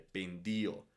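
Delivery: "Pendio" stressed on the i, pen-DI-o; accent the i in "Pendio". Shown as a demonstration. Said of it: "simplemente es la pronuncia". ("Pendio" acentuado en la i, pen-DI-o)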